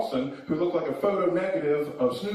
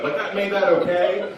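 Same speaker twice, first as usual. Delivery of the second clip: normal talking voice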